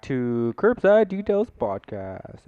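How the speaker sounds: big booming show intro; announcer voice. Acted. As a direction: stretch it out like a radio host.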